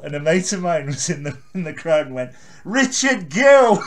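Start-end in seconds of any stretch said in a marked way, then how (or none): 2.6-3.8 loud, high heckle, drawn out; yelling out. Retold or imitated. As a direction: shout the name across the club. Mate yelling from the crowd.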